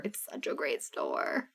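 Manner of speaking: slow unvoiced whisper of the praise; hushed, slow aside. Shown as a demonstration. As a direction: breathe it like a secret. Repeats line, then whispers it.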